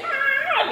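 high yelp. Retold or imitated. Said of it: "no hice".

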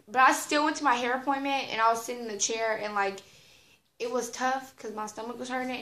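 normal talking voice

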